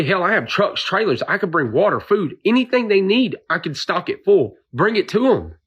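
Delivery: pleading to be allowed to help; agitated pleading. Real, not acted.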